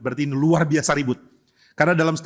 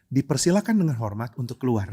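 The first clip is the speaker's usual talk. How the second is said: polite; 5 semitones lower than usual. Shown as a demonstration. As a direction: show people out with courtesy. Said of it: "gimana cara usir orang yang sopan?". (sopan dan hormat, pelan)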